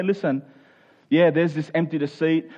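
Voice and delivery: normal talking voice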